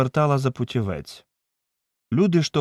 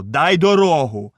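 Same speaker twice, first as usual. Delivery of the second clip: slow, loud demand to clear the way; angry shout; 4 semitones higher, 5 dB louder than usual. A narrator's reading. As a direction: drag out the order at the crowd. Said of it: "кричали довкола нього".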